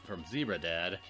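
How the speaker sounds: ordinary talk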